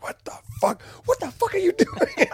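high-pitched mock freakout at yourself; squeaky mock panic. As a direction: squeak it like you've lost your mind. Mocking own confused sleepwalking self.

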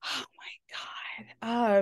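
breathy unvoiced whisper of disbelief; barely voiced, appalled. Real, not acted. Cohost gossips about a reality show, whispering in disgust.

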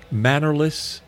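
ordinary talk

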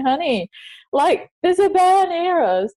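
high-pitched, acted-out alarm; freaking out. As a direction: retell a panicked warning to a partner, pitch jumping up. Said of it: "I am freaking out going".

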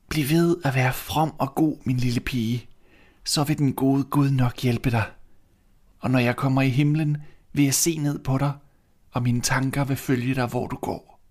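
high, gentle dying mother; dying mother's voice. Acted. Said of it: "kaldte hun på sin eneste datter og sagde". (høj, blid stemme som en døende mor)

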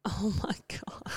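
breathy shocked whisper. Real, not acted. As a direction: whisper it in disbelief. Shocked at nugget habit.

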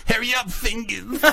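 laughing plea. Real, not acted. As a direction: laugh through the words. Laughing while urging.

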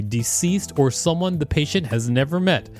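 ordinary delivery

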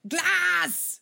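mock child scream. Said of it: "skriker rakt in i skärmen".

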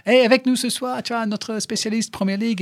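high-pitched mock radio introduction; radio presenter. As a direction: announce the guest like a radio host. Acts out radio presenter introducing him.

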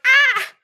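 short high loud group shriek; mock scream. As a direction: shriek in shock with everyone. Everyone shrieks at the accident.

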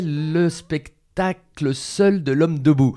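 slow, high and deliberate title read. Real, not acted. Naming the one-man show, slow and high. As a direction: lay out each word of the title. Announcing the show's mock title.

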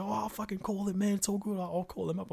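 high mock bloke voice; other player voice.

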